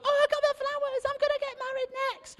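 high, squealing excited bridesmaid; girly gushing voice. Retold or imitated. Bridesmaid mocked by preacher, squealing high with delight. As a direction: gush like a girl who caught the bouquet. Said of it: "they go".

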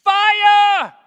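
high bellowed shout; shouting fire. Acted. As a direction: call fire down at full voice. Elijah shouting again.

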